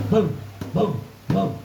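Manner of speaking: loud booming knocks; knocking sound. Acted. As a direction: pound out three knocks. Knocking on the door.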